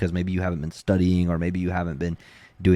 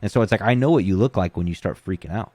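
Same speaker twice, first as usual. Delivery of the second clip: usual speaking voice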